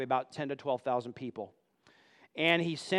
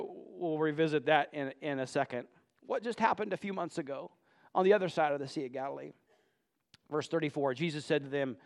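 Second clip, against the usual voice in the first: ordinary delivery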